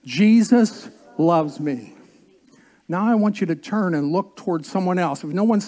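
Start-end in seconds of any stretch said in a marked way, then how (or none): 0.0-2.0 slow, loud group declaration; slow, loud unison proclamation; leading congregation aloud. Shown as a demonstration. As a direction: say it out loud with the room. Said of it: "Here we go, together".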